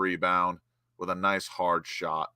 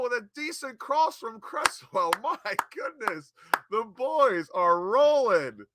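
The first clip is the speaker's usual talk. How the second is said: far higher, slower, laughing in disbelief; overwhelmed with joy at a great header; ecstatic goal reaction; 8 dB louder, slower than usual. Real, not acted. Fan reacting to Bowen's header goal, ecstatic and laughing.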